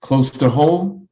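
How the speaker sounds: measured poem reading, each phrase lifted then dropped; poetry recitation. Acted. Poet reading a draft-notice poem to an audience, measured recitation. Reads own poem aloud.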